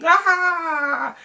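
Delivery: sudden high mock scream. Retelling the movie ending to viewers, a high mock scream. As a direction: shriek it like the screaming cult leader. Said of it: "he just starts screaming, like".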